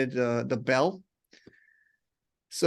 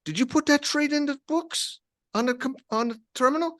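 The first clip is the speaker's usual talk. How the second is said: mock angry trader; 9 semitones higher than usual. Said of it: "And he's like".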